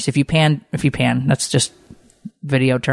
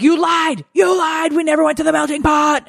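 put-on kid shouting accusation; 13 semitones higher than usual. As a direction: shout it like an outraged little boy. Son KJ protests broken promise.

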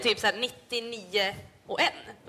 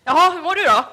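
much louder, higher, hearty greeting; imitating dad; 6 semitones higher, 11 dB louder than usual. Imitating her father arriving at the caravan, loud and hearty. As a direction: boom it like a cheerful dad. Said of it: "Och så kommer han in".

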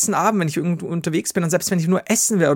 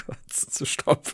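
laughing; 10 dB quieter, much slower than usual. Real, not acted. Laughing break.

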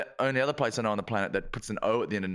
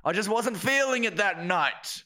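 high-pitched call across the street; retold crowd chant, pitched up; mock yell; 7 semitones higher than usual. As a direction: call it out as a mock public excuse. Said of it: "Yell that out to that strange lady".

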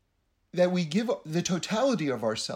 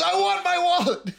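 high, louder hammed-up insistence; mock demand; 12 semitones higher, 5 dB louder than usual. Guest demanding his wallet from the Rebbe's wife, high mock-loud. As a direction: demand it back like a wronged man.

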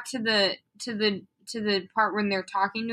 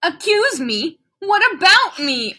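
growled; 7 semitones higher, 5 dB louder than usual. A narrator's reading. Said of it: "I growled".

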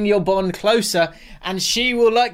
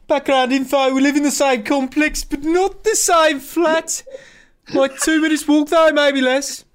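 put-on Brummie accent; 8 semitones higher than usual. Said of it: "I'll try it for a sentence".

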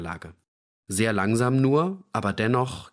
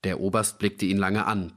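ordinary delivery, nothing marked

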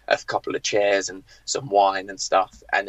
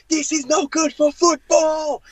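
slow, high-pitched mock fury; hammed-up drawn-out outburst; seething manager; 4 dB louder, slower than usual. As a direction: imitate a boss raging, drawing out each word. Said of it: "seething, ... breathing fire".

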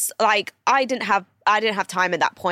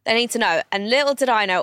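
ordinary delivery

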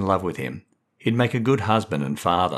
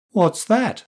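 put-on child's question; 13 semitones higher, 3 dB louder than usual. A narrator's reading. Little boy asks sister.

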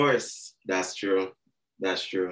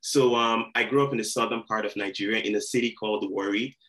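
ordinary talk

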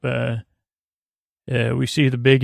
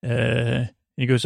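ordinary delivery

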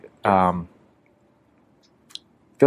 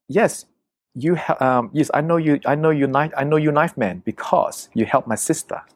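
higher-pitched imitation of a girl; local girl; 6 semitones higher than usual. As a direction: mimic a local girl explaining herself. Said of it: "so she said".

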